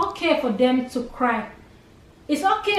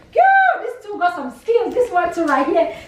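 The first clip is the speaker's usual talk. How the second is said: excited praise; 4 semitones higher than usual. Real, not acted. Aunt praises the young actors' skills, excited praise. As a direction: gush with real delight, pitch leaping up.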